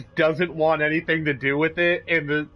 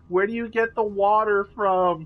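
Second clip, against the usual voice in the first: whiny sister voice; 6 semitones higher than usual. Retold or imitated. Mimicking whiny stepsister.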